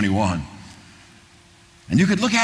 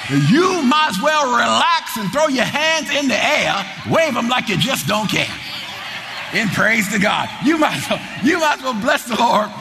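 full-force preaching; 5 semitones higher than usual. Acted. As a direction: rouse the congregation with certainty.

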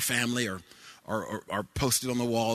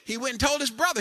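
ordinary talk, nothing marked

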